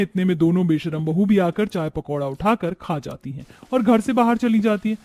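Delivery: ordinary delivery, nothing marked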